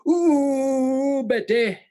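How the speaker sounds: long, high-pitched swooping ooh; disguised catchphrase impression. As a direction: wail the ooh high, then the name. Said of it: "That's the way I'm disguising it".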